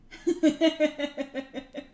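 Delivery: short amused laugh; a quick laugh at the joke. Real, not acted. Streamer laughing at French accents.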